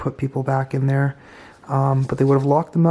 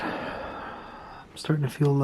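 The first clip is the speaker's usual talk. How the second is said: slow unvoiced whisper; nervous night vlog whisper; 10 dB quieter, much slower than usual. Real, not acted. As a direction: breathe it, slow and dizzy. Dizzy in the cell.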